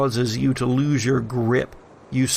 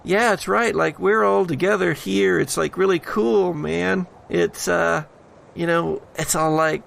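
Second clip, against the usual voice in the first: higher, chill bro voice; laid-back surfer dude; 5 semitones higher than usual. Acted. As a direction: talk like a relaxed dude selling comfort. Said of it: "another voice joins".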